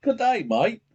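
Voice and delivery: loud, high put-on Australian greeting; mock Australian accent. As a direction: call it out in a fake Aussie accent. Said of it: "sounds more like the Australian".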